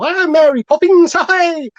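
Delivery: high, loud, lovable cockney impression; chirpy cockney. Podcaster imitating an actor's real-life voice, chirpy lovable cockney. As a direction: pitch it up into a chirpy mock-cockney call. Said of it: "He's very cockney, very chirpy, lovable".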